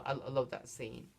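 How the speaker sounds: ordinary talk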